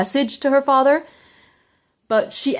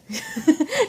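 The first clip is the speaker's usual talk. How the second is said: short high laugh; a quick giggle at the dog; 6 semitones higher, 4 dB quieter than usual. Real, not acted. Laughs after dog toy.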